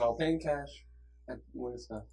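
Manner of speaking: soft teacher imitation, trailing down low; soothing teacher. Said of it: "he has the most soothing voice".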